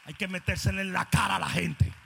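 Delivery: loud, high fervent sermon; loud impassioned preaching; full-force preaching. Acted. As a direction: preach it to the back row.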